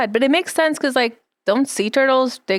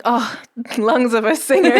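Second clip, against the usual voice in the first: joking quote cracked by giggles; laughing mock admiration. Co-host imagining listeners praising the creature's singing, laughing. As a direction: play impressed and break into a laugh.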